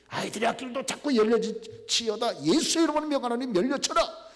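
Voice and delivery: high, loud declaration in Jesus' name; commanding proclamation. Shown as a demonstration. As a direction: proclaim it with full authority. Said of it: "예수 이름은 막 하는 거죠". (높고 크게 선포하는 목소리)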